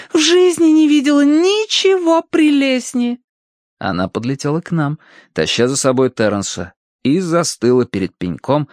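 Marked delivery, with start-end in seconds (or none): 0.1-3.2 very high, gushing rapture; Tossie. A narrator's reading. Tossie admiring the hideous stump, rapturous gushing. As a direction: gush in a squeaky, sky-high voice. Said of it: "восторженный возглас Тосси".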